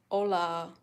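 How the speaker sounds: usual speaking voice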